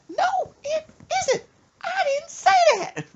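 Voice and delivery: very high, loud screeching scold; mock furious mama. Mother scolding smart-aleck son, screechy mock fury. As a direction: screech like a mother at her wits' end. Mother disputes son's claim.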